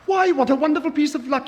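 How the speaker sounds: high, loud and fast delight; excited cry. A narrator's reading. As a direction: burst out with glee at the window. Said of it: "he cried".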